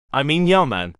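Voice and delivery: rehearsed Jamaican lilt; Jamaican accent. A narrator's reading. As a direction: correct yourself into a Jamaican accent. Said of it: "with the Jamaican accent he'd been practicing".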